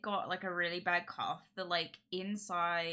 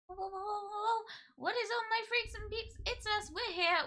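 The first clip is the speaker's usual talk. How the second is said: excited high greeting. Real, not acted.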